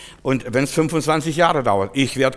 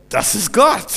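high, raised exclamation; triumphant proclamation; 8 semitones higher, 5 dB louder, slower than usual. Real, not acted. Preacher credits God for the healing, triumphant proclamation. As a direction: proclaim it to the whole congregation.

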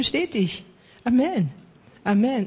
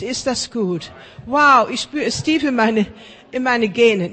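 loud, high declaring preacher; fervent blessing; 4 semitones higher, 3 dB louder than usual. Acted. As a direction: bless them with full force. Preaching blessing at full force.

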